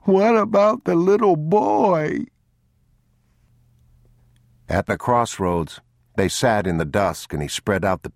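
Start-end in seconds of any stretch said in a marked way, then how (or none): 0.0-2.2 high, loud, drawn-out wail; sobbing child. A narrator's reading. Boy refused by his father, loud drawn-out sobbing. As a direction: wail it slowly through sobs. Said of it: "he sobbed".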